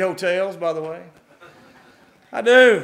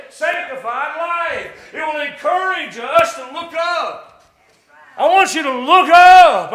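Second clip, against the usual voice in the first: preaching shout; 4 semitones higher, 3 dB louder than usual. Real, not acted. Preacher urging the church to look up, shouting. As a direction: lift them up with a big pulpit call. Preacher builds to altar call climax.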